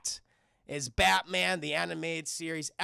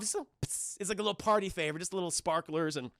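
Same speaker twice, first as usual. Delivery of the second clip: ordinary delivery, nothing marked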